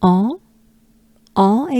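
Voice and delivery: "en" as open nasal French vowel, rising. Shown as a demonstration. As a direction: model the nasal "en" in isolation. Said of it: "same sound as Dampierre".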